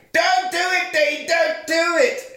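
high shrill-pitched pleading yell, slowed; mock pleading scream. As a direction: beg him to stop, hammed up. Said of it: "Kelly's going".

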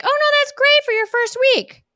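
very high, loud sweet coo; sugary mock reassurance. Group members soothing the newbie, very high and sugary. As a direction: gush fake comfort. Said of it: "people were like".

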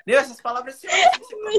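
high, loud mock exasperation; teasing the student's panic, high and loud. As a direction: play the overwhelmed learner, hamming it up. Teacher mocking student's panic.

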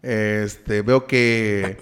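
low, slow psychiatrist; deep serious doctor. Retold or imitated. Psychiatrist voiced in retelling.

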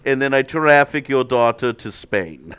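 put-on high, loud Slovenian accent; hammed-up Balkan tough-guy accent. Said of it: "you get to do a Slovenian accent".

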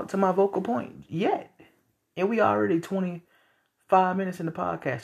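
ordinary talk, nothing marked

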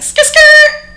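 very high loud tire-screech sound; a squealing skid to a stop; screeching brakes noise. Retold or imitated. Reacting to the news, a high screech noise. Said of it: "I was just like".

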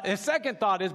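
ordinary talk, nothing marked

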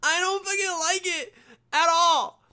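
high, loud, crybaby whine; mock the offended in a high wailing whine; mocking whiny sensitive person. Mocking sensitive people who hate the word, high whiny wail. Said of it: "sensitive fucks that are just like".

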